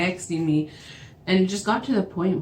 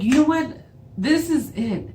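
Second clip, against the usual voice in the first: high, fed-up announcement; raised-pitch indignant ultimatum; retold exasperation; 8 semitones higher than usual. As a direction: re-enact reaching the last straw. Ending it in retold quarrel.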